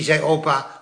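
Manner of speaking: ordinary delivery, nothing marked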